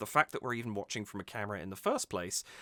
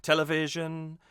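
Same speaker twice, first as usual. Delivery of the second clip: higher "Television" leaning on VI, end held; stress on VI; 5 semitones higher, 3 dB louder than usual. Shown as a demonstration. Singing coach repeats the good version, "Television" stressed on VI. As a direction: punch VI in "Television", then hold the ending. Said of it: "making v still more emphasized".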